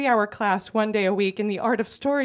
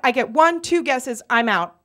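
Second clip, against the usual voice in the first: ordinary talk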